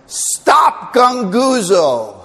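slow, loud, high order; mock stern command. Apostle telling the grumblers to stop, loud slow command. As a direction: bark the ban on grumbling. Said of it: "what he's telling them is".

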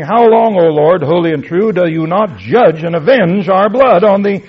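martyrs crying out loud to God. Retold or imitated. Preacher voicing the slain souls' loud plea to God, loud and high. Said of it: "They cried with a loud voice".

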